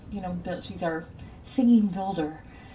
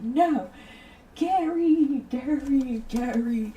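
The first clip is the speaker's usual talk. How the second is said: retold yell; 7 semitones higher, 6 dB louder, much slower than usual. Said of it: "He's like".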